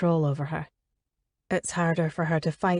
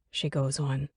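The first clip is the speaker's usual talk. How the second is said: usual speaking voice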